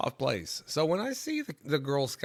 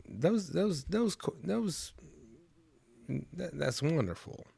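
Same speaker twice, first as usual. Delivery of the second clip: choked up, high and halting; getting emotional; 7 semitones higher, slower than usual. Real, not acted. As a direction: stammer it out, overcome with feeling. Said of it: "I'm getting emotional".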